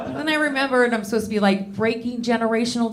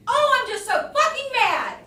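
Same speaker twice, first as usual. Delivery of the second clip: mock furious mom; 13 semitones higher than usual. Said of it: "I'm just like".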